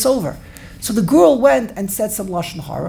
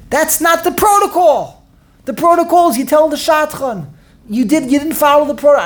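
mock scolding; 7 semitones higher, 4 dB louder than usual.

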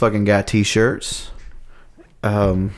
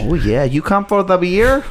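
higher, lilting mock Wisconsin accent; Norwegian-sounding Wisconsin accent; 6 semitones higher than usual. Shown as a demonstration. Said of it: "that sounds kinda Norwegian".

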